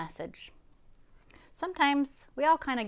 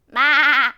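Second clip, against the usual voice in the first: loud, raised wavering bleat; goat's bleat; 5 semitones higher, 14 dB louder than usual. A narrator's reading. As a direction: bleat hard like a goat. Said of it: "said a goat".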